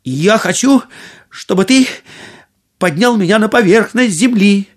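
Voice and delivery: summoning courage. A narrator's reading. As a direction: steel yourself and give the order. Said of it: "собрался с духом и произнёс".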